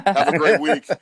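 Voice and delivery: loud, laughing goodbye. Real, not acted. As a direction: laugh through the farewell. Laughing sign-off.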